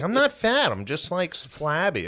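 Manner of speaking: high mocking friend impression. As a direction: whine it like the defensive buddy. Mocking friend's retort on phone.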